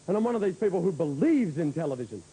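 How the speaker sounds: ordinary talk, nothing marked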